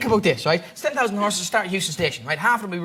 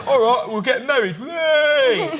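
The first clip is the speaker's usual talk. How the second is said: loud, higher whooping delight; rowdy stag-party cheer; 5 semitones higher, 5 dB louder, slower than usual. Acted. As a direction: shout it like a celebrating groom's gang.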